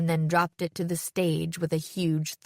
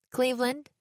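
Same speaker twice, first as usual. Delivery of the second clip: light, higher, lilting answer; easy reply; 6 semitones higher than usual. A narrator's reading. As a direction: toss it off without hesitation. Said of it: "I replied easily".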